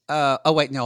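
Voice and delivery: ordinary delivery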